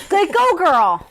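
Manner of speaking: high, drawn-out cheer; mock cheering. Host cheers a mother standing up for her kids, high cheer. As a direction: whoop her on from the sidelines.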